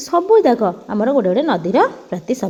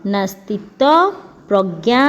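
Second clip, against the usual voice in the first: slow and clear; 3 semitones lower, much slower than usual. Shown as a demonstration. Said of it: "ତାର ସମାସ କ'ଣ ହେଇଯିବ?".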